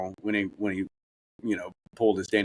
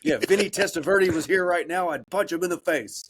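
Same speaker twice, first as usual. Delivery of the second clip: mock tough guy; 8 semitones higher than usual. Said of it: "mock threat".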